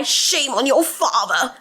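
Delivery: put-on slow Hollywood villain accent. Poster scolding a man over his father, villain accent. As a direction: draw it out in a stagy villain accent. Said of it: "I'm sorry I do the accent".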